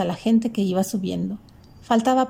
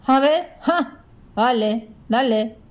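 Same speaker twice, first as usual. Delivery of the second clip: goading, in short raised bursts; defiant taunt; 3 semitones higher, slower than usual. A narrator's reading. Grandfather challenging the driver to hit him, defiant. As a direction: dare him to strike. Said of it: "lo desafió el abuelo".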